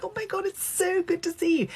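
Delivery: high, put-on squeal of delight; gushy, high-pitched hello; gushing greeting. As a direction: fake being thrilled to see an old friend. Gushing fake greeting to celebrity.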